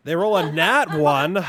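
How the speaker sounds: loud, slow, thrilled shout; excited shout. Real, not acted. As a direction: cheer it out loud. Rolling a natural one.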